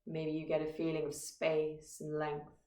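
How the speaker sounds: calm, measured meditation guide with long pauses; guided meditation. Acted. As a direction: guide listeners gently into stillness, phrase by phrase. Leads a meditation.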